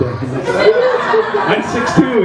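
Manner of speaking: drawn-out, laughing protest. Real, not acted. Father laughs about his own height, drawn-out. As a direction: stretch it out through a laugh. Laughing at being called little.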